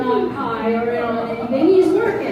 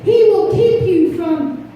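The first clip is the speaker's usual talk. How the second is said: fervent preaching; 4 semitones higher than usual. Acted. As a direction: preach it to the congregation. Preaching at full force.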